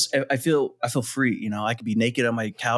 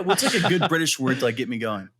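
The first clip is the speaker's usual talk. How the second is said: usual speaking voice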